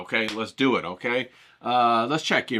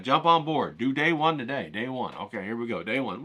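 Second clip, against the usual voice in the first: ordinary delivery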